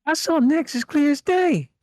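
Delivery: townsperson voice. Acted. Townsperson telling the sheriff what they saw, in a high put-on voice. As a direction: squeak it out like an excited witness. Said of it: "The townsperson one".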